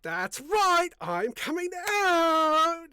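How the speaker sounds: high, slow, showy camp voice. Retold or imitated. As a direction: ham it up, high and sassy. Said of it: "in the gayest voice I could".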